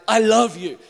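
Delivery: loud, over-stressed I love you like a war cry; over-accentuated. Shown as a demonstration. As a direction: bellow "I love you" as if charging into battle. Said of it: "you over-accentuate it".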